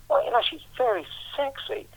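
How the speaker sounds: high-pitched, lightly acted admiration; admiring thought. Acts out admiring thought about [name].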